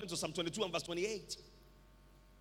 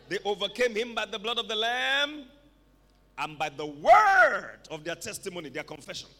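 full-force preaching; 2 semitones higher, 12 dB louder than usual. Real, not acted. Preacher urging the congregation to tell their neighbor, full-force preaching.

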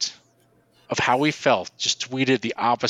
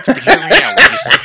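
much higher and louder, a squeal; squealing excitedly; 8 dB louder than usual. Real, not acted. Pleading with co-hosts to hear him out, squealing. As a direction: squeal out the plea.

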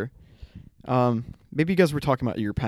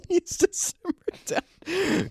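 high giggly words; laughing; much slower than usual. Real, not acted. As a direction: talk through a laugh.

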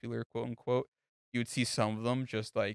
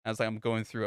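ordinary talk

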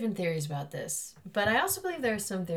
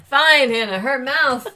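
loud laugh into a grudging reply; laughing concession; 6 semitones higher, 12 dB louder, slower than usual. Real, not acted. Friend conceding to Hannah while laughing. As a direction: give in laughing.

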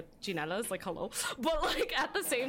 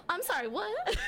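stunned disbelief; 11 semitones higher than usual. Retold or imitated. Shocked at learning real dad.

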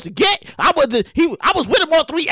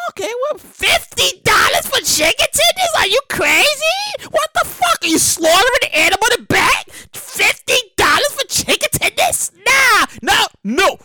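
price outrage; 8 semitones higher than usual. Pretend. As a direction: rant at the crazy price. Shocked by menu prices.

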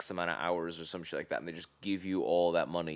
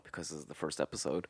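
whispered aside; 5 semitones lower, 11 dB quieter, faster than usual. Real, not acted.

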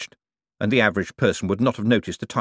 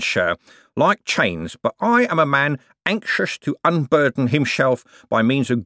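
pious, slurred con man, pitched up; penitent thief act; 5 semitones higher than usual. Acted. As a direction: lay on the false remorse with whistling sibilants.

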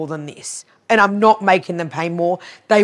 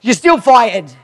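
raised, louder mock-indignant retort; stubborn dismissal; 5 semitones higher, 8 dB louder, slower than usual. Retelling herself firing the accountant regardless, stubborn. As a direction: hold firm, high and loud.

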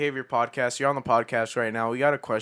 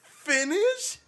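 high laughing question; incredulous. Real, not acted. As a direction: echo it in amused disbelief. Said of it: "I've never heard finish".

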